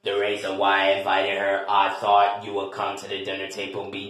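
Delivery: low, even explanation; disappointed host character. Acted. Low-voiced relative character explains.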